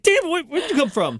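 high, slow startled cry; mock shock at a kid walking in. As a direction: jump at the sudden interruption. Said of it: "It's like".

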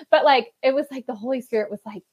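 ordinary talk, nothing marked

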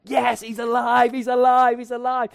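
high, loud joyful shouting; ecstatic shout. Retold or imitated. Disciples celebrating the risen Lord, shouting. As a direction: cheer it out, jumping for joy. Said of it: "jumped around like".

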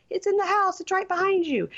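high-pitched spooky warning; hammed-up creepy suspense; scary suspense. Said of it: "they have the scariest, suspenseful music".

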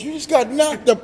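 high, loud squealing movie-quote yell; Chris Tucker. Retold or imitated. Friend retelling a movie scene, loud high-pitched Chris Tucker impression. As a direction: shriek it like the comedian in the film. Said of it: "Chris Tucker goes".

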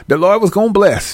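high, urging relative's voice; excited family member. Acted. Family telling the rapper it's his time, excited. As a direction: cheer them on in a high-pitched voice. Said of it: "Then you got people in your family".

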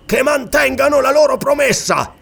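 high, angry outburst; irate exclamation. A narrator's reading. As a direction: demand it angrily, pitched well up. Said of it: "esclamò in tono irato". (esclamazione in tono irato)

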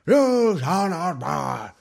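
hammed-up heavy Gandalf; super heavy wizard voice. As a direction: declaim it like a wizard holding a bridge. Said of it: "super heavy".

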